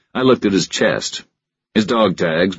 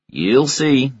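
Stockton voice; 9 semitones higher than usual. A narrator's reading. Stockton teases.

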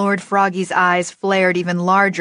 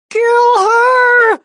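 shrill screaming panic, very high; high-pitched shriek; 10 dB louder than usual. Acted. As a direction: shriek it in pain. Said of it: "his voice two octaves higher".

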